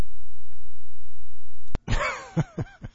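big laugh. Real, not acted. Reacts to sneeze story.

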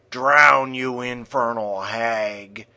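spits out the curse, loud on Drown; old monk cursing the hag; angry grumble. A narrator's reading. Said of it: "grumbled the old monk".

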